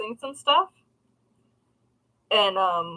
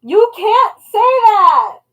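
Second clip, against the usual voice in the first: loud, slow, high scandalized cry; scolding shout; 10 semitones higher, 10 dB louder, much slower than usual. Retold or imitated. Teacher scolding a child about backwards letters, loud and slow.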